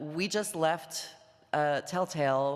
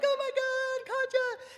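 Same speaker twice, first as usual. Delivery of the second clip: thrilled; slower than usual. Retold or imitated. Sean thrilled to meet Katya.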